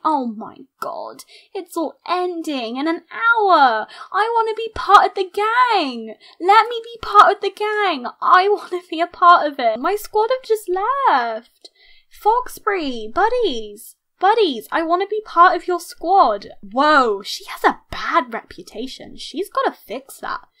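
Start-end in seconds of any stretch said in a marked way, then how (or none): 4.1-15.7 high, loud, whiny begging; eager pleading. Real, not acted. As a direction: beg to be let in on the fun. Begging to join the departing gang.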